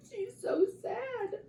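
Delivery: high pretend sob of sadness; play-cry for the heartbroken girl; mock crying. Parent fake-crying for sad Sue to child.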